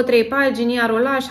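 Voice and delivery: ordinary talk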